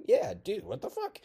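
high, loud indignant shout; acted squealing protest; outraged yell. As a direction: fire back in loud outrage. Acted quarrel, outraged reply.

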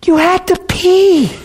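slow, high-pitched squeak; mock tearful. Buddy reminds his weeping friend, mock tearful squeal. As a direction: squeak it out as if crying along. Teller replies, crying along with friend.

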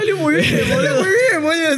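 high, laughing distorted squeal; distorted glitch voice. Pretend. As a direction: mimic a deformed glitching horror voice. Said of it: "Es exactamente igual".

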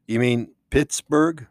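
usual speaking voice

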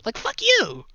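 high, loud burst of outrage; indignant outburst. Real, not acted. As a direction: spit it out, fed up.

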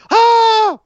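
high, slow drawn-out ah; ecstatic shout. Retold or imitated. Reliving Naito's win for the co-host, a long high cry. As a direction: let out the joy of the win.

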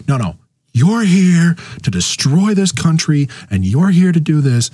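high, hammed-up shouting at an opponent; mock yelling over a calm speaker; mock screaming. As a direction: shriek the accusation in mock outrage. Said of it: "you start going".

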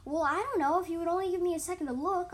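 high, loud protest; exasperated retort. A narrator's reading. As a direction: snap back, fed up.

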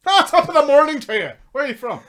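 high, loud mock Irish accent; thick Irish accent. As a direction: put on a hammed-up Irish greeting. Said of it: "had a thick Irish accent".

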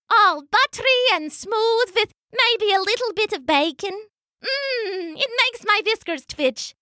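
high-pitched little mouse voice. A narrator's reading. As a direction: pipe up as a tiny mouse. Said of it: "Lucia the little mouse".

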